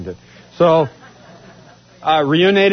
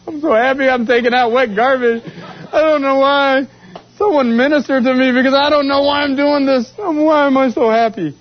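higher, laughing, overjoyed; laughing joy; 8 semitones higher than usual. Real, not acted. Busboy marveling at his own joy, laughing higher.

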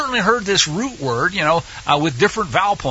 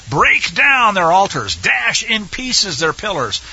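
slow, higher, weighty stress on "Break down" and "dash"; very emphatic; 3 semitones higher, slower than usual. Acted. Teacher reading commands to destroy altars, very emphatic. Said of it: "it basically is very emphatic".

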